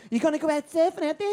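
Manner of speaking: mock Australian accent. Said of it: "And the Australians were so nice".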